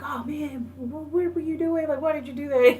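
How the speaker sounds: high-pitched, imitated dismay; retold high protest, building in volume at the end; mock upset protest. Acting out upset character after punch.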